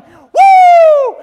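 very high, very loud whoop; shouting crowd. Retold or imitated. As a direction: let out a full crowd whoop.

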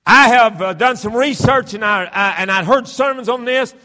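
loud, high-pitched pulpit shouting; full-force preaching. Acted. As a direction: proclaim hellfire to the whole congregation, hammering key words.

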